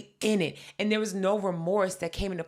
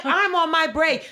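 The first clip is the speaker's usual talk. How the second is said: higher and louder, snapping back; fed-up clerk; 9 semitones higher, 8 dB louder than usual. Retold or imitated. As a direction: fire it back at them. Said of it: "She's like".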